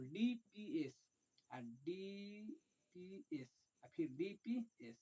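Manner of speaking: slow dictation. Shown as a demonstration. Teacher dictating the order to students, slowly. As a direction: spell it out slowly so they can write. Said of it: "अब D को लिखेंगे".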